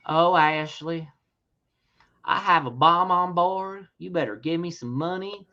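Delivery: slow, drawn-out polite threat; calm hijacker with accent. Pretend. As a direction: make a bomb threat nice and calm, taking your time. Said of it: "sounded very nice and calm with an accent".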